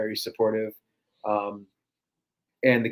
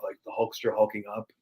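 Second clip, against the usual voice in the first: ordinary talk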